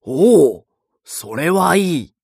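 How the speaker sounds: put-on loud, high joyful old mouse; delighted. A narrator's reading. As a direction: cry out in delight. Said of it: "手を打って喜びました". (大喜びの声)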